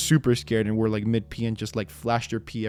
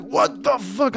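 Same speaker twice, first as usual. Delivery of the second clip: startled; 12 semitones higher, slower than usual. Retold or imitated. Caught off guard in the bathroom, startled high exclamation. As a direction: jump at a sudden noise. Startled mid pee by clicker.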